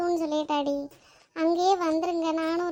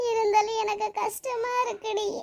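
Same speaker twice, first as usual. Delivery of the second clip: higher, tearful wail; 4 semitones higher than usual. Acted. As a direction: whimper it through acted tears. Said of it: "இனி அழாத". (அழுகைக் குரல்)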